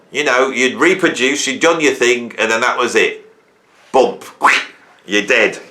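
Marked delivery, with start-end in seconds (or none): none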